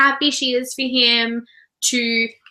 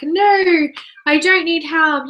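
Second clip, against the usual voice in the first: higher, louder retold protest; mock indignant refusal; 5 semitones higher, 4 dB louder, slower than usual. Co-host retelling Joe refusing help, mock indignant. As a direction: push back hard, in imitation.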